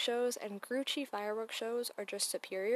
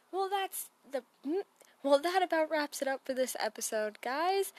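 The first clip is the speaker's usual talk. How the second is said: podcast outro voice; 6 semitones higher, 7 dB louder than usual. Acted.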